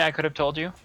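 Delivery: normal talking voice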